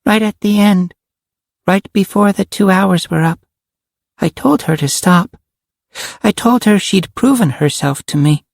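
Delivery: put-on high little-girl voice; a child's high voice, recounting gravely; little girl Karen, solemn. Karen tells of Cassie's sacrifice, solemnly.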